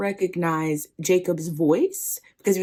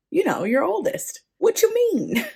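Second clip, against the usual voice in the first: high-pitched, baffled protest; incredulous; 12 semitones higher than usual. Acted. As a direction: pitch leaping up in disbelief. Son baffled his father doesn't know him.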